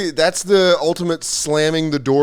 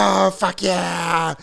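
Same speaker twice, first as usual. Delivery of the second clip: high, drawn-out mock moan; aroused Greek; 4 semitones higher, much slower than usual. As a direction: moan very slowly in hammed-up pleasure. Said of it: "And they're like".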